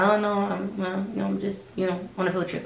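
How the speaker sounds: imitated quiet, evasive mumble; dusting them off. Coworker dusting off the stranger, quietly evasive. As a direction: hedge softly and vaguely to end it. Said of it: "he was just trying to dust them off".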